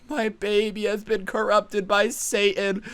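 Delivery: mock sobbing plea; imitating crying mom. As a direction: ham up a distraught religious mother. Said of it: "the mom starts crying".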